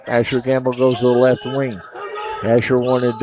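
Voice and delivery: usual speaking voice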